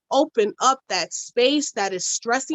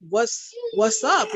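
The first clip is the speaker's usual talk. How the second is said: pitched way up, drawn out with concern; a high, drawn-out worried check-in; high slow worried ask; 8 semitones higher, much slower than usual. Retold or imitated. Worried call to friend.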